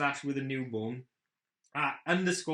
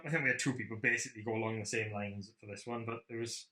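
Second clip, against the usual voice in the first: usual speaking voice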